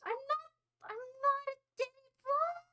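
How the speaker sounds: very high, strained mock protest; hammed-up high plea; high-pitched pleading. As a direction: protest in a squeaky put-on voice. Pretend plane crash victim protests.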